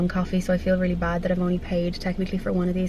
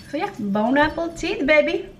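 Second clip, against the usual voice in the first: playful; 7 semitones higher than usual. Real, not acted. Playful sign-off to viewers.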